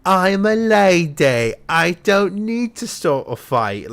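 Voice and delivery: high, drawn-out little princess cat with sass; Marie from the Aristocats. Acted. Owner voicing her female cat, sassy high princess voice. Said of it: "Mochi's voice is gonna be like Marie".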